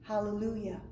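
ordinary delivery

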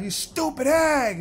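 slow, high, drawn-out mock scorn; imitating his old frustrated sneer; retold mock insult. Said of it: "I used to get mad".

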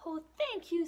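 bright happy thanks; high relieved little cheetah; grateful cheetah. Acted. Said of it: "says Little Cheetah".

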